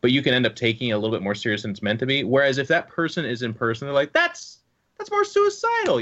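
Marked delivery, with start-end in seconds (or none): 4.1-6.0 much higher, slow sing-song tease; playful teasing inflection. Retold or imitated. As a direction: say "that's more suicidal" as a light, friendly jab. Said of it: "saying it like that 'cause the voice inflection".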